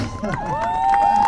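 a quick burst of high laughter; sudden high giggle before saying goodbye; short high laugh. Real, not acted. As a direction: laugh it off lightly, embarrassed. Nervous laugh mid-thanks.

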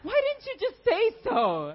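high, slow, laughing; laughing retort. Retold or imitated. To the church, laughing as she replays his question. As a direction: say it through laughter, incredulous.

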